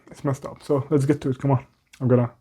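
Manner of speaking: normal talking voice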